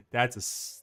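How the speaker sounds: ordinary delivery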